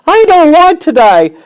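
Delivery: very high, loud panicked pig voice; terrified screaming. Acted. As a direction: scream for help in terror. Wilbur screaming in terror.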